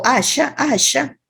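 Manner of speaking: allá with sh, Argentine style; southern sh in allá; Argentine accent. Shown as a demonstration. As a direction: Rioplatense allá with sh sound. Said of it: "los argentinos".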